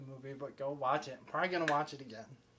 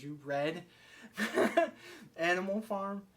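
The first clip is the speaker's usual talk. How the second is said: laughing imitation of a shocked question; mock disbelief, rising higher and breaking into laughter; retold shock; 5 semitones higher, 4 dB louder than usual. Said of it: "looked at her with a shock on her face".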